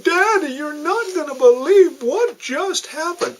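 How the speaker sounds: put-on high, breathless child voice running in; excited little girl. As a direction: play the daughter bursting in, wide-eyed. Daughter bursts in excited.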